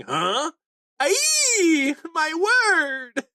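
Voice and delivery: high, loud, yelping Aye; startled cry. Retold or imitated. Roto reacting to the Haki burst with a shocked high cry. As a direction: squeal it out in shock. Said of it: "Very funny".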